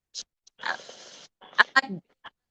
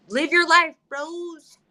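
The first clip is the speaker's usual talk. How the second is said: exuberant shout; 8 semitones higher, 6 dB louder, slower than usual. Real, not acted. Exuberant call.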